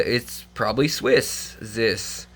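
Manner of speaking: ordinary talk, nothing marked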